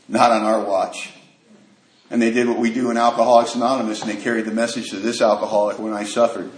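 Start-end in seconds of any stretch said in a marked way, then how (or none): 0.1-1.1 quiet solemn vow. Retold or imitated. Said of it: "looked at me and said".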